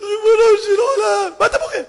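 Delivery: high, loud mock sobbing; hammed-up crying, pitched far up; acted weeping. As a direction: wail it out between sobs. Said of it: "והתחלתי לבכות ביחד איתו".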